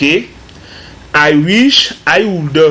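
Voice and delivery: drawn out, very slow; slow model sentence. Shown as a demonstration. Teacher models the wish sentence for students, very slowly. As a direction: stretch each word for learners. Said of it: "teacher models the sentence".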